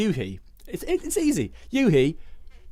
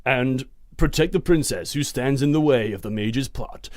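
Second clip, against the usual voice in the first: epic film voice; 3 semitones higher, 5 dB louder than usual. Pretend. Reading the synopsis to his co-host as an epic trailer voice. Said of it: "Should we do this in an epic film voice?".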